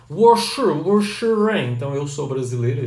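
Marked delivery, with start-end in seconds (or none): 0.1-1.7 WOSHI REN pronounced slowly, high and loud; Mandarin pronunciation demo. Shown as a demonstration. Teacher modelling Mandarin for Brazilians, WOSHI REN high and loud. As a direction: say WOSHI REN drawn out with exaggerated tones. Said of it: "Então, você fala assim".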